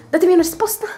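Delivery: high loud demand; mock outrage. Real, not acted. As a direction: call out for the answer. Emphatic demand to viewers.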